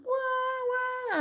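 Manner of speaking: long high wail of thrill; drawn-out excited yelp. Retold or imitated. Reliving the motorcycle chase for viewers, long high yelp. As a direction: stretch it out like a movie stunt. Chase story, mock exclamation.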